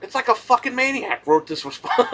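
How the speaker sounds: words breaking into a high laugh; laughing in disbelief. Real, not acted. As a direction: laugh at how absurd the rant was. Laughing at the owner's rant.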